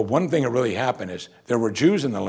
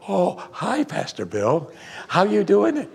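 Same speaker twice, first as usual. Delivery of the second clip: much higher sugary greeting; mock sweet phone voice; 9 semitones higher, slower than usual. Spouse caught mid-fight greeting the pastor, sweetly higher. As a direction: switch instantly to sweet and polite.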